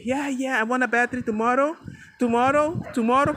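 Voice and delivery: put-on fast, excited kid pleading for tomorrow; child voice.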